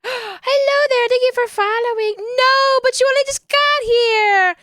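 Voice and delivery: light up for the new follower; excited greeting. Real, not acted. Streamer greeting a new follower in chat, high delighted gasp.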